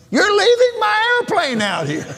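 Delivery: very high, imitated child's wail of protest; hollering little boy. Young son protesting to dad at the airport, mock child's holler. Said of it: "and John hollered".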